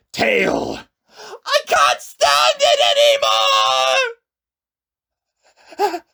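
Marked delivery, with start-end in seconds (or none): 1.2-6.0 crying out. A narrator's reading. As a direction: scream it out in pain. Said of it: "he cried out".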